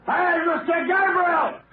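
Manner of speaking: high, slow shout into the distance. Acted. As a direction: yell for him across the woods. Calling out for missing man.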